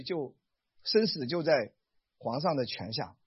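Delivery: ordinary talk, nothing marked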